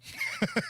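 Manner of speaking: high laugh cracking up; burst of laughter. Real, not acted. Co-host cracking up at his own parody, a high laugh. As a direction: crack up at your own joke.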